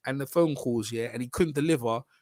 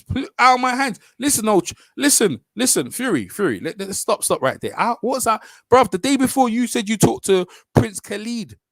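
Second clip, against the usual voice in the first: higher and louder, cutting him off; heated shouting; 6 semitones higher, 5 dB louder than usual. Acted. Arguing back at Fury and cutting him off with a loud voice. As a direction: shout him down mid-sentence. Heated exchange playing both fighters.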